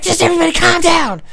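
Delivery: very high, loud mock shriek; frantic girlfriend. Girlfriend shouting over a fight, imitated high shriek. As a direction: yell for order as the girlfriend. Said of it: "his girlfriend ran out".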